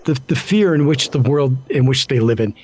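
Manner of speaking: ordinary delivery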